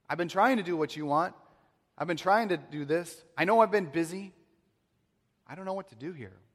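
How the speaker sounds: high, loud, strained pleading; desperate plea. Acted. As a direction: beg from the end of your rope. Elijah pleading honestly.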